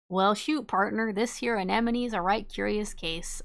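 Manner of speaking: playful Southern cowboy read; put-on Southern twang of a cowboy; Southern cowboy accent. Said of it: "we're choosing a southern accent today".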